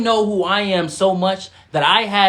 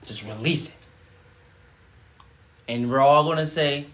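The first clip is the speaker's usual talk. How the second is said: guided breathing. Acted.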